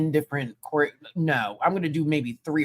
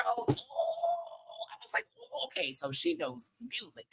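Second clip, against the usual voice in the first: surprised; 11 dB quieter, slower than usual. Retold or imitated. Retold delighted surprise.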